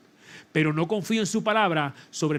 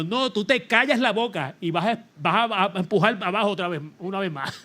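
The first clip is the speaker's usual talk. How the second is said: a light firm push back at his own body; firm self-command; 3 semitones higher than usual. Retold or imitated. Preacher talking back to his body, mild firm retort. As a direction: order yourself to keep going, half laughing.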